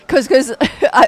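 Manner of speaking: high, loud, breaking into laughter; stammering through a real laugh. Real, not acted. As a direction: crack up while trying to explain. Cracking up over the accent.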